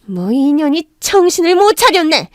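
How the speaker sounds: mother-in-law rages at her daughter-in-law; furious yell. Acted. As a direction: snap at the insolent daughter-in-law. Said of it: "노발대발 소리를 지르며".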